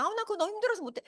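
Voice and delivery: high, whiny complaint; lightly mimicking a whiny young worker. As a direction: whine like a tired thirty-something. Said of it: "삼십 대가".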